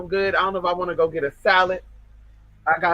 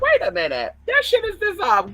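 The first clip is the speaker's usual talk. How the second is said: much higher, louder squeal of surprise; shocked realization; 4 dB louder than usual. Retold or imitated. Vlogger retelling her shock at the dissolved card with a much higher, louder squeal. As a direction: shoot way up in disbelief.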